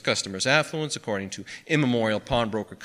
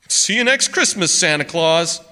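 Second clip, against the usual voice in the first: loud, high parting shot; mocking farewell; 8 semitones higher, 10 dB louder than usual. A narrator's reading. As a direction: call it out as a taunt.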